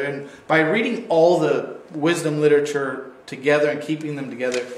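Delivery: normal talking voice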